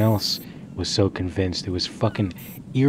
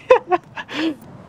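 high falling giggle; amused laugh; 4 dB louder than usual. Real, not acted. Player who jumped too late, high laughing.

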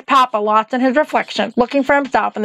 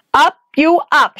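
raised voice, slowed and higher; witch shouting; 5 semitones higher, 4 dB louder than usual. Acted. Witch barks from the stairs at Dorothy, raised and slow. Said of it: "shouted the witch".

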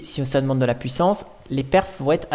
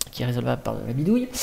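fast, lower repetition; hurried and lower; quick aside; 4 semitones lower, 4 dB quieter, much faster than usual. Real, not acted. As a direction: rattle it off as an aside.

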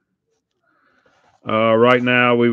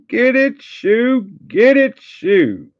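excited teasing; 13 semitones higher, 4 dB louder, slower than usual. Real, not acted. Teasing the friend named Shoe.

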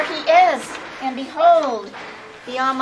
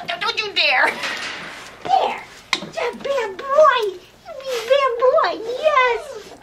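much higher, slow playful scold; 12 semitones higher, slower than usual. Real, not acted. Playfully scolding the dog, high slow baby talk. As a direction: tease him as a naughty darling.

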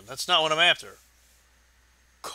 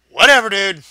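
loud exasperated whatever; 5 semitones higher, 12 dB louder, faster than usual. Real, not acted. Exasperated shrug at his audience.

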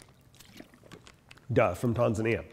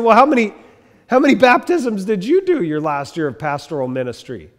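louder, challenging mock question, higher at the start; feisty comeback; 7 semitones higher, 7 dB louder than usual. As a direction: fire the question back with mock indignation. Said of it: "little feisty".

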